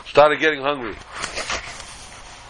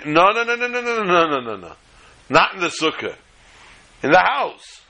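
emphatic refusal; 2 semitones higher than usual. Acted. Host to guest about the sukkah, firmly refusing. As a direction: wave off the idea in one tumbling string.

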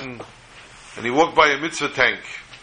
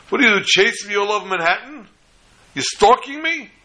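mock screaming; 8 semitones higher than usual. Fed-up man to the Bacher chasing him, mock screaming. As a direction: retell an exasperated yell, pitch climbing on the questions. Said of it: "he starts screaming at him".

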